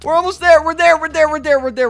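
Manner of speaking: loud, high, repeated urging; rally them frantically toward the exit; excited shout, easing as it repeats; urgent reassurance. Pretend. Urging on hurried princess.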